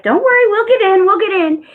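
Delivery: high, loud pet-talk voice; retold reassurance. Woman tells her bird they'll get in, imitated reassurance.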